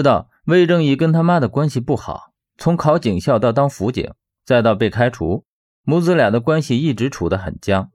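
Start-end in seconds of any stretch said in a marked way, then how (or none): none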